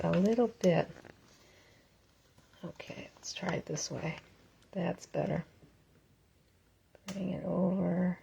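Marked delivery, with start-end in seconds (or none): 2.6-8.2 soft unvoiced whisper to herself. Real, not acted. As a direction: whisper it under your breath. Muttering to herself while adjusting.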